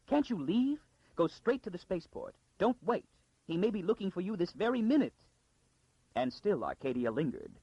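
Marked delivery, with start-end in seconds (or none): 0.1-5.1 a high, urgent frightened noblewoman; frightened lady. Acted. Frightened Lady Kalia hurrying Arcadia out, high and urgent. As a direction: press the girl to take everything and go.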